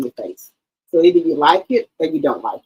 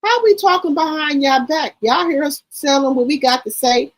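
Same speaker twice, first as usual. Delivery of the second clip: higher pitched mock denial; higher, lightly voiced aunties insisting; mocking the relatives; 8 semitones higher than usual. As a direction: imitate the aunties brushing it off. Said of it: "they said".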